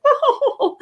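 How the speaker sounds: high-pitched mock flattered "oh"; flattered mother. Mother told she is funny for the first time, flattered. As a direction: imitate a mother thrilled by a compliment.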